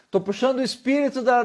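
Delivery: ordinary delivery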